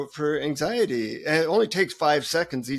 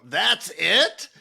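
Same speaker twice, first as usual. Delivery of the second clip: raised pitch, rising in disbelief; acted-out let-down disbelief; incredulous question; 4 semitones higher, 3 dB louder than usual. Seeker questioning the guru's special mantra, incredulous.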